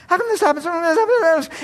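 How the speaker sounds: put-on high, curious child voice; imitating a kid. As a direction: pester like a small child. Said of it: "My kids are full of questions".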